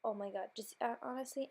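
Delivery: ordinary talk, nothing marked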